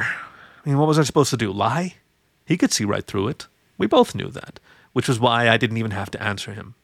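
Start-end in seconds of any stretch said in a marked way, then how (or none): none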